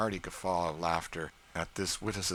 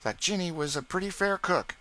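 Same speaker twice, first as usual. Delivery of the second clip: put-on teasing boy; 12 semitones higher, 5 dB louder than usual. A narrator's reading. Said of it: "said George".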